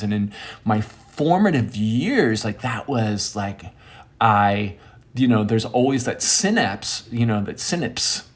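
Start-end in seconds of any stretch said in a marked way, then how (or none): none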